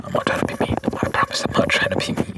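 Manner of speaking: quick emphatic whisper; fast aggressive asmr. Shown as a demonstration. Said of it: "repeats line, now whispered".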